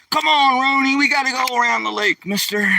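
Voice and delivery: high, quick doggy talk. Real, not acted. Talking to his dog.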